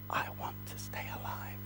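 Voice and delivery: very soft, quick whisper; whispered prompt. Shown as a demonstration. Teacher feeding child Esther's plea, whispered prompt. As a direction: whisper the line for someone to repeat.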